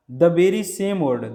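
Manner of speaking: the very same word drawn out slowly, word by word; teacher slowing down to stress very same; slow emphatic demo. Shown as a demonstration. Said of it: "he spoke".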